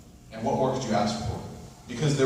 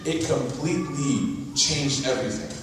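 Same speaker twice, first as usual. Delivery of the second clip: ordinary delivery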